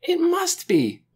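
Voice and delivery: very high, drawn-out exclamation; excited cry. A narrator's reading. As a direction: cry it out, sure of the discovery. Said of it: "cried Lena".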